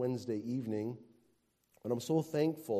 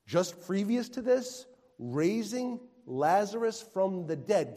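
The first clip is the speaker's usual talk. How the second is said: ordinary talk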